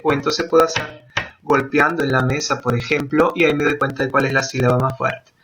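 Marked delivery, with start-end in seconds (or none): none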